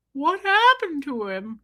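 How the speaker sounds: high, slow character voice; put-on pet voice. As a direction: imitate a distressed pet, drawing it out. Voices Bo reacting to the death.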